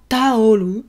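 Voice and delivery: "taolu" as ta-o-ru with a light flap; Japanese katakana pronunciation. Shown as a demonstration. Teacher showing how towel sounds in Japanese. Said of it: "en japonais".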